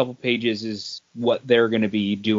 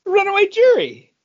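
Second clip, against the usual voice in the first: calling out; 7 dB louder than usual. Pretend. Shouting the book title jokingly.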